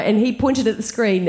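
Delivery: ordinary talk, nothing marked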